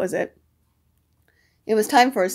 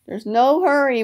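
ordinary delivery

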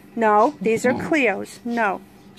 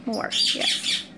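tiny pet voice; 6 dB quieter than usual. Pretend. Voicing the bird's answer.

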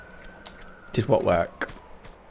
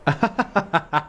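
high, staccato giggle; 9 semitones higher than usual. Real, not acted. Player amused by the game, giggling. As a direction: let out a rapid high laugh. Laughs while playing.

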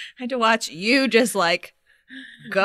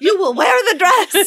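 laughing order; 9 semitones higher, 4 dB louder than usual. Retold or imitated. Acted dress-up quarrel, laughing.

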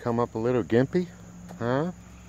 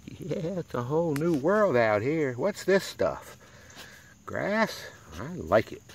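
lightly voiced happy puppy, higher pitch; playful dog voice; 7 semitones higher, slower than usual. Owner voicing the puppy exploring the grass, high playful voice. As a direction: give the puppy a high, wide-eyed little voice. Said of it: "He's like".

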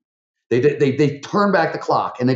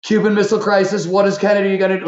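excited present-tense alarm; 9 semitones higher, 4 dB louder than usual. Retold or imitated. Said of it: "talking in the present tense".

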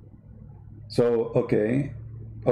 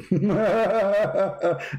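mocking laugh; 6 semitones higher than usual. Real, not acted. Mocking the shape claim.

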